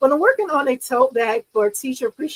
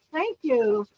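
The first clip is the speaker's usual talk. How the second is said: ordinary delivery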